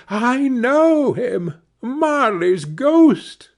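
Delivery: high, slow, drawn-out eerie cry; flame crying out. A narrator's reading. The dying flame leaps up and cries out, high and slow. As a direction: let the leaping flame cry it out, stretched and high. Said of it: "as though it cried".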